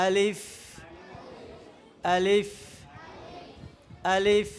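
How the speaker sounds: low, even আলিফ with a long hissed final f; correct আলিফ. Shown as a demonstration. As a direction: end আলিফ on a clear labiodental f. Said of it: "শুদ্ধ উচ্চারণ করতে হবে দেখো".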